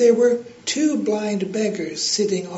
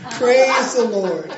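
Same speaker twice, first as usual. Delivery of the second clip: loud, slow, triumphant praise; jubilant; 3 semitones higher, 6 dB louder, slower than usual. A narrator's reading. Lady celebrating the devil paying for groceries, jubilant loud praise. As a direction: shout it in joyful triumph. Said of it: "jumping up and down and clapping".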